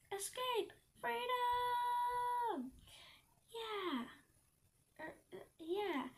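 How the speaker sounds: high, drawn-out cry of freedom; triumphant yell. A narrator's reading. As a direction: stretch it out like an escapee's cheer. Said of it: "I yelled, turning back".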